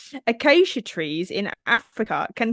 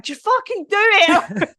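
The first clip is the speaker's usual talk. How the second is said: high, loud mock yell; exasperated high-pitched heckle, laughing; impatient kids; 9 semitones higher, 5 dB louder, slower than usual. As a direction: imitate kids losing patience, slowly punched out. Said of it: "Hayley and Josie was like".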